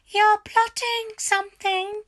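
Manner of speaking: high, loud nagging hag voice; shrill old wife. A narrator's reading. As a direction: snap at him like a suspicious old hag. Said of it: "Missus Twit said".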